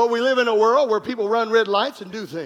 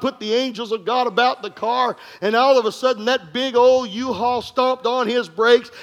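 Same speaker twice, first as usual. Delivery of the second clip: ordinary talk, nothing marked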